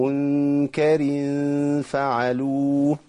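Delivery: منكر فعلوه chanted slowly with a wrong ghunna; wrong recitation. Shown as a demonstration. Teacher demonstrating a common recitation error. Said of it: "ده خطأ طبعًا".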